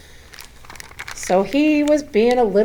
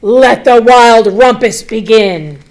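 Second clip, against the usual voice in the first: put-on loud, slow proclaiming boy king; boy king calling the party to start; Max crying out; 7 dB louder than usual. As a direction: announce the party with glee. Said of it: "cried Max".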